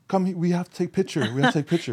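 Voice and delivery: put-on high, broken-English Brazilian accent; imitating Brazilian woman's broken English. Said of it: "She's like".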